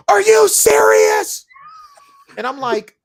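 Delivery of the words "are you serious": slow, high, loud disbelief; incredulous shout. Retold or imitated. Wife retelling her reader at the cliffhanger, shouting in disbelief. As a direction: blast it out like you can't believe it. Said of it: "She looked at me and goes".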